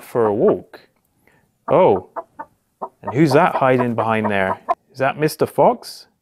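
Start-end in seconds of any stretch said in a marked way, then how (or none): none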